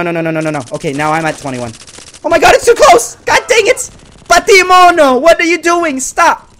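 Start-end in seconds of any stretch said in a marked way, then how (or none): 2.2-6.5 high, loud panicked yelling; worked-up gamer outburst; frantic shouting. Real, not acted. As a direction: yell in alarm at your opponent. Frantic reaction to close match.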